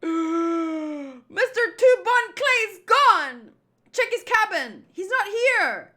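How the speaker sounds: high, loud cries of alarm; alarmed shouting. Acted. Crew finding the captain gone, alarmed high shouts. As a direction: shout the news across the ship, panicked.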